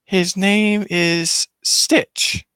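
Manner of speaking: put-on slow, high child's voice; Leo. Child names the new pet, slow and high. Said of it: "And then Leo's like".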